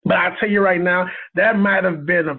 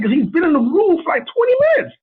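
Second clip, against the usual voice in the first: panicked rush; 12 semitones higher, 3 dB louder than usual. Retold or imitated. Panicked call to Lisa.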